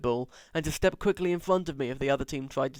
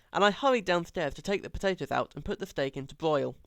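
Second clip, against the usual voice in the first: usual speaking voice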